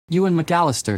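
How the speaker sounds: calls his name loud and high across the room; calling out. Acted. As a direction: shout for Ewan, irritated. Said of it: "she called out".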